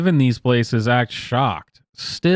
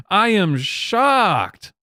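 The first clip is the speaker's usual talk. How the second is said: very slow, higher, deadpan shock; sarcastic mock shock; 6 semitones higher, much slower than usual. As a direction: stretch out each word, clearly not shocked.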